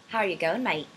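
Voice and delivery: amateur attempt at an Australian accent; bad Australian accent. Shown as a demonstration. Vlogger taking a viewer's dare, bad Australian accent. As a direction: have a go at Aussie, badly. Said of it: "I'm really bad at Australian accents".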